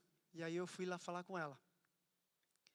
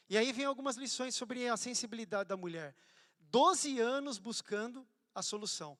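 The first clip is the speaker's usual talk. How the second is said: fervent preaching; 3 semitones higher, 8 dB louder than usual. Acted. Pastor preaching to the church, loud and fervent. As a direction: preach it out over the crowd.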